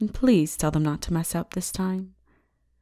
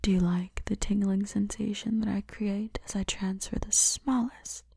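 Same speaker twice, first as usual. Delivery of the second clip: seductive whisper. Acted. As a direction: whisper to him as if drawing him close. Seductive whispers to her captive.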